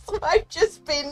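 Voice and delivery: laughing. Real, not acted. Laughing after being kicked.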